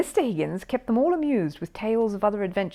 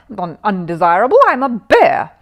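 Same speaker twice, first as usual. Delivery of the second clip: indignant protest; 2 semitones higher, 10 dB louder than usual. A narrator's reading. Paddington protesting to the desk man. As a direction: insist on it, offended.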